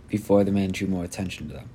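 plain reading voice

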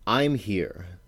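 usual speaking voice

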